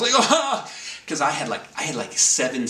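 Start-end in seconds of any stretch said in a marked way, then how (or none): 0.1-0.7 a very high, loud yelp of fright; mock-screaming in shock; panicked shriek. Realising the stings, a high loud shriek. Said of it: "I started freaking out".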